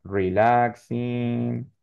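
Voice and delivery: very slow Relaxing, each sound clear; drawn-out teacher model. Shown as a demonstration. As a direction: stretch Relaxing, land the final -ing. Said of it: "sino con I N G".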